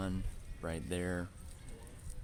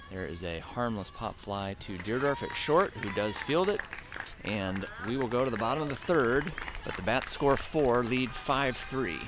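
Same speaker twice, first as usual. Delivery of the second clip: rising, louder call of the play; excited play-by-play; 6 semitones higher, 5 dB louder than usual. Acted. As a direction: build the call up with energy.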